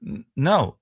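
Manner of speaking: usual speaking voice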